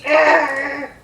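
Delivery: raspy imitated baby squawk; baby noise. Baby hears porridge is on offer, raspy squawk. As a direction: make a rough little baby's cry of approval.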